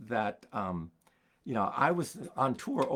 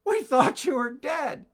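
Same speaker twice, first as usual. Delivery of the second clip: much higher and louder startled cry; shocked friends; 8 dB louder than usual. Retold or imitated. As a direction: gasp it out as if seeing a ghost. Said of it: "They said".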